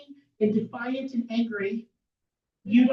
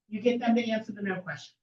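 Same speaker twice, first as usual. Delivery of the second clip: normal talking voice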